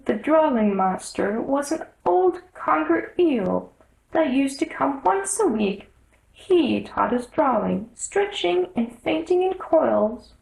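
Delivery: lifted, plaintive Mock Turtle voice listing odd school subjects; mournful Mock Turtle. The Mock Turtle sadly lists his lessons to Alice, high and plaintive. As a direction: pitch it up and let it sound forlorn.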